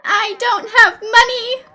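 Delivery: frustrated outburst. Acted. Frustrated rant at game characters.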